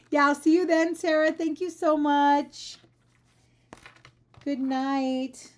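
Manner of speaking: loud, laughing thank-yous; warmly gushing at a friend; excited. Real, not acted.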